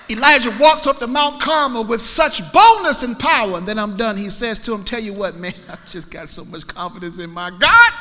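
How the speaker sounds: high, loud pulpit fervour; full-force preaching. Acted. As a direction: preach it boldly with the voice lifted.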